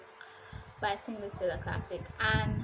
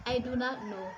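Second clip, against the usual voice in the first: ordinary delivery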